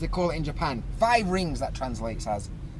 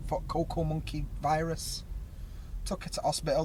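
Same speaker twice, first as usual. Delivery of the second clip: ordinary delivery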